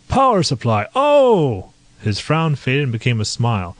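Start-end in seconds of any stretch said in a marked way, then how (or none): 0.1-1.7 high, loud burst of remembering; sudden recognition. A narrator's reading. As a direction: it suddenly clicks.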